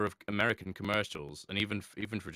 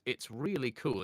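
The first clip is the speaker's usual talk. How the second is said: ordinary talk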